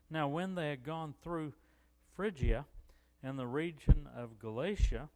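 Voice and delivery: loud, deliberate Bible reading; declaiming the text loudly; scripture reading. Real, not acted. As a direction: read the verse out to the congregation.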